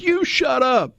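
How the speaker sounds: high-pitched imitation of an angry retort; retold yell back. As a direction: act out yelling someone down. Retold shouting match at Graceland.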